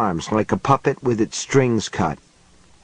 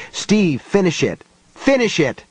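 urgent call; 7 semitones higher than usual. A narrator's reading. Urgent plea during eagle fight.

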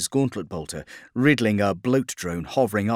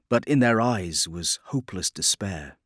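usual speaking voice

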